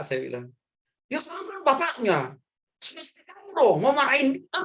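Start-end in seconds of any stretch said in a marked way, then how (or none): 1.1-4.5 very high mock scolding; protesting father. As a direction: imitate a grandfather objecting to the apology. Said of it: "Orang tua saya protes". (tiruan ayah protes, melengking dibuat-buat)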